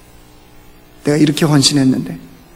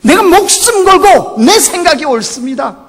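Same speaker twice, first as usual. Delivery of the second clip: Jonah insisting; 10 semitones higher than usual. Retold or imitated. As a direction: voice Jonah demanding that God do it his way. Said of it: "이게 요나예요".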